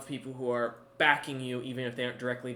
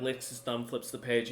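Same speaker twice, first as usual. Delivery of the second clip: ordinary talk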